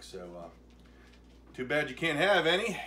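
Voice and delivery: normal talking voice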